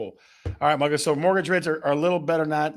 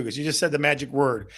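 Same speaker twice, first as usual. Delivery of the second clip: normal talking voice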